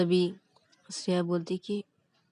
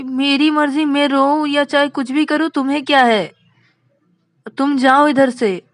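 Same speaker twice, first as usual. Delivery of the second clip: loud, high, hurt snapping; angry outburst; 4 semitones higher, 8 dB louder than usual. Acted. Rani orders Vikas to go away, angry outburst. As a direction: lash out forcefully, easing slightly toward the end.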